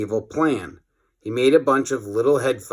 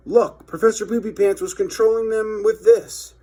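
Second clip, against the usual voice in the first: put-on high kid voice; 10 semitones higher than usual. Kid explains.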